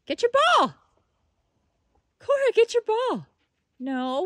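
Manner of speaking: very high, loud cheering; excited dog cheer. Real, not acted. Owner cheering her dog on at fetch, high excited.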